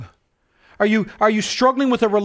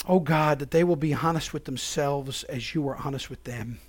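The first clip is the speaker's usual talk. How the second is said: soft, earnest prayer voice; closing prayer; 6 semitones lower, 6 dB quieter than usual. Real, not acted. Pastor prays over the congregation at sermon's end, soft and earnest. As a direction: pray over the congregation humbly.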